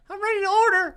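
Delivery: put-on high-pitched little kid ordering; squeaky pretend kid voice; little Danny. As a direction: pipe up like a small child in a restaurant. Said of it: "I'm, I'm little Danny".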